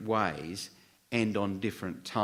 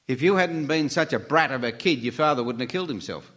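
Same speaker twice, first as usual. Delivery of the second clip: accusing uncle; 5 semitones higher, 5 dB louder than usual. Retold or imitated. Retold uncle blaming grieving child.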